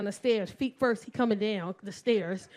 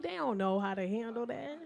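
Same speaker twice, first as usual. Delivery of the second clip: ordinary delivery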